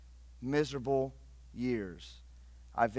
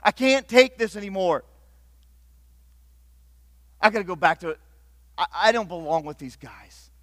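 loud, higher, fed-up cry of quitting; frustrated outburst; 4 semitones higher, 8 dB louder than usual. Acted. Peter shouting that he's quitting, fed up. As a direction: throw up your hands and shout you're done.

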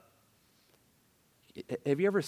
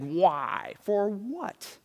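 resentful questioning, rising high on "what"; bad attitude; 5 semitones higher, much slower than usual. Shown as a demonstration. Preacher showing his congregation the bad attitude, resentful challenge. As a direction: question it with indignant pushback. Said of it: "this is the bad attitude".

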